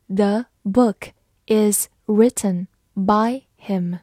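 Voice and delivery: slow, word-by-word model reading. Shown as a demonstration. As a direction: read slowly, each word on its own. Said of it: "慢读一遍". (慢速逐词朗读)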